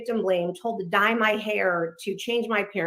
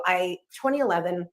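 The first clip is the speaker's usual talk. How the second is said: usual speaking voice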